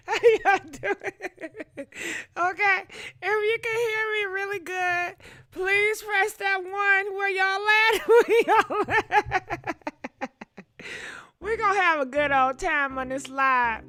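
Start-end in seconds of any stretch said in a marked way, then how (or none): 0.1-9.8 high-pitched, laughing through every word; can't stop cracking up while asking; giggly call-out to the viewers. Real, not acted.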